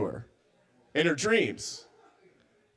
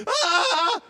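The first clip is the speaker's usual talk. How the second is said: high mock shriek of fright; scream; 4 dB louder than usual. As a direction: shriek like seeing a monster. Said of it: "She was like".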